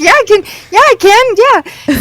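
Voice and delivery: high-pitched, hammed-up reassurance; overeager yes. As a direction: squeak out an imitation of bluffing agreement. Retelling her overeager yes to the author.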